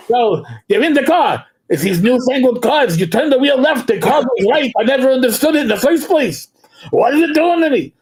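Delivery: put-on exasperated Yiddish-accent routine; Jackie Mason. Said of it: "it's kind of like Jackie Mason".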